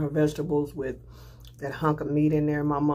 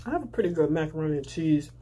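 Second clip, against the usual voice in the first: chewing while talking; faster than usual. Real, not acted. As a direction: mouth partly full. Seen 0: bite taken at -2; chewing, hand covers mouth 2.8-3.1.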